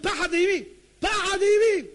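high, loud preacher's cry; ringing cry of awe; awestruck exclamation. Real, not acted. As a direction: proclaim the dread at full voice.

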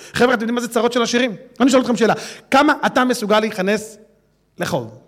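ordinary talk, nothing marked

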